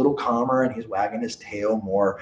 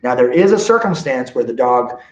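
ordinary delivery

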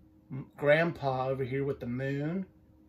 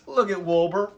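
ordinary delivery, nothing marked

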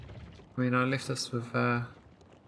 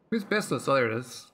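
normal talking voice

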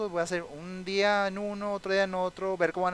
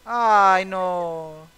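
dismayed; 2 semitones higher, 10 dB louder than usual. Real, not acted. His sim wife is crying.

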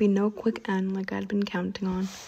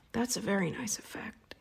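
friendly menacing vampire; 8 dB quieter than usual. Acted. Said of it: "his voice friendly again".

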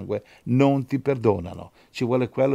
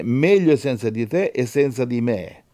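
ordinary talk

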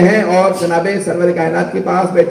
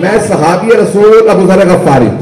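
preaching proclamation; 3 semitones higher, 5 dB louder than usual. Acted. Companion proclaims Ghadir to the crowd, building to full force. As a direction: build from firm declaration to high, loud cry.

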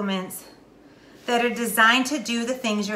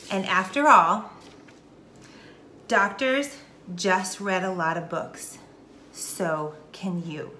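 deliberate, with long pauses between phrases; very slowly. Real, not acted. Teacher giving the one key takeaway, very slow and deliberate. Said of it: "I'm gonna say this very slowly".

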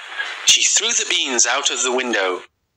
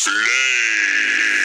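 low, booming giant command; 4 semitones lower than usual. Acted. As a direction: bark the order like a giant. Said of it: "said the giant".

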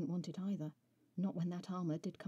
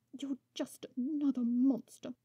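higher, louder angry spit; spat back; 7 semitones higher, 8 dB louder than usual. A narrator's reading. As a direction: hurl the insult at him. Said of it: "She spat back".

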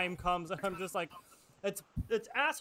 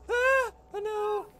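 high startled shriek; startled scream; 14 semitones higher, 7 dB louder, slower than usual. Real, not acted. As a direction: jump out of your skin. Zombie face appears.

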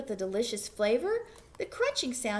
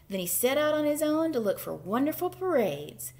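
usual speaking voice

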